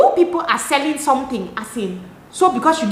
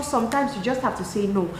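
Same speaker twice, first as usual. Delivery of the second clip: ordinary talk, nothing marked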